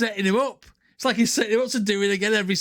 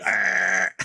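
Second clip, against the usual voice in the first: growl imitation; 13 semitones lower than usual. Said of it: "walk around going".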